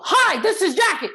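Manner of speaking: high, loud, bubbly over-the-top hi; overexcited caller. Shown as a demonstration. Agent overwhelming a calm homeowner, overexcited and loud. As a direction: burst in far too eager. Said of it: "you're gonna scare the heck out of them".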